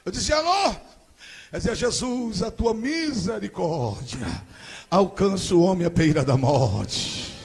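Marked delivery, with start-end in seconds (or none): 0.3-0.8 high, loud phone call; loud, high-pitched hello down the phone. Retold or imitated. Calling on phone again. 1.8-7.3 fervent prayer. Retold or imitated. Cousin praying on the phone.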